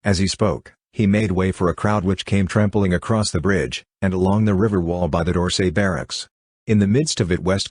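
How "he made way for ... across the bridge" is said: ordinary delivery, nothing marked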